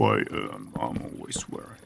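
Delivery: whispered, sheepish aside; a whispered guilty aside; whisper confession. Real, not acted. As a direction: admit it under your breath. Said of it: "I'm swearing too much".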